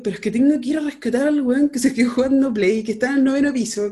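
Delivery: urgent, high insistence; agitada. Retold or imitated. Discute con mamá. (discutiendo con su mamá para ir a rescatar al esposo, voz alta y urgente)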